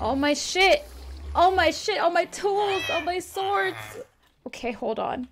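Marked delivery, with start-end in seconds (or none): 0.0-3.8 dismayed wail. Real, not acted. Lamenting lost gear.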